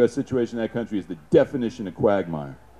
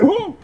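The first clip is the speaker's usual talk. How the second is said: mortar whoosh; 5 dB louder than usual. Retold or imitated. Mortar round lands nearby.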